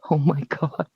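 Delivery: very soft retold exclamation; acted quiet disbelief; hushed shock. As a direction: say it under your breath, stunned. Retold hushed shock at his answer.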